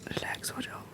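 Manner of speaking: very soft whisper, close by; hushed, barely voiced reassurance; whispered soothing. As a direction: murmur comfort into a hug. Soothing someone during a hug.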